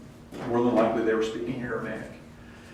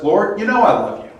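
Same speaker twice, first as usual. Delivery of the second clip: higher, louder earnest protest; earnest Peter; 8 semitones higher, 7 dB louder, faster than usual. Retold or imitated. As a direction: insist it with feeling. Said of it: "Peter says".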